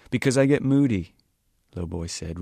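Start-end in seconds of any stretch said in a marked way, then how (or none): 0.1-1.2 "Because I get moody" said louder and higher; raised voice. A narrator's reading. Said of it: "raising his voice".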